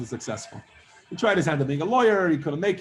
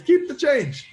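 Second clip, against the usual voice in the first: higher and louder, showing off; swaggering big shot; 8 semitones higher, 5 dB louder, slower than usual. Acted. Flashy rich man tipping.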